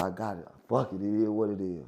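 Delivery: low, soft, resigned; subdued and deep in pitch; retold resignation. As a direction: say it quietly, accepting whatever comes. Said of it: "I said".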